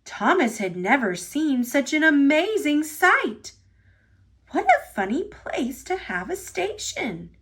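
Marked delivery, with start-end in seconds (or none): 4.5-7.3 a higher, small engine voice, lightly voiced; curious little chirp of a line; peeping. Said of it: "he peeped".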